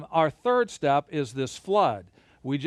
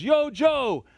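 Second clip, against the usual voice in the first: higher and louder, calling up to Joe; foreman calling down; 9 semitones higher, 3 dB louder than usual. Retold or imitated. Foreman on the tower yells to Joe for wood, calling out. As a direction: shout down from the top of the tower. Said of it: "he goes".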